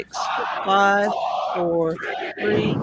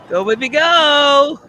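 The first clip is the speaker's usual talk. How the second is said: slow, loud, higher cheer, all in; loud excited cheering; 5 semitones higher, 8 dB louder, much slower than usual. Real, not acted. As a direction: yell it on as it climbs. Cheering the rocket on.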